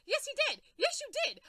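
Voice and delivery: high, loud accusing repeat; furious accusing. Real, not acted. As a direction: hammer the accusation. Accusing Batman.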